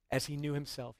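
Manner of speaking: usual speaking voice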